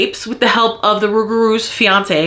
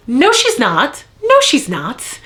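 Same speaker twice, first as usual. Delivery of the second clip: two insistent denials, pitch up; firm denial; 2 semitones higher, slower than usual. Real, not acted. Vlogger refuting the book's praise of Murphy, insistent. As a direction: refute it twice. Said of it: "I'm so mad right now".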